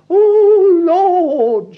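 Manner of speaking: imitating a weepy falsetto pray-er; mock falsetto prayer. Mocking the lady praying at intercession, high falsetto cry. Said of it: "in a high falsetto, quavering note".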